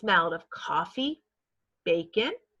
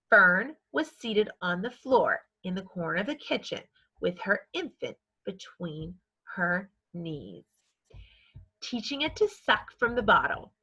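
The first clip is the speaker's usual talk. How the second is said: ordinary delivery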